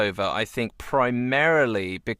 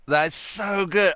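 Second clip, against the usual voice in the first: delighted; 8 semitones higher, slower than usual. Real, not acted. Delighted reaction.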